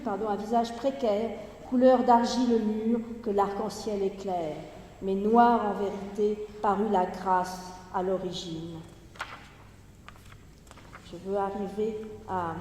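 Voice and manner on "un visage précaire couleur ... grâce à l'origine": projected, measured poetry recitation with falling line endings; Gedichtlesung. Acted. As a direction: declaim the verse in phrases set apart by pauses. Resumes the poem after slip.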